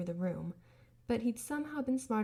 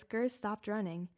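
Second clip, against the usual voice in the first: usual speaking voice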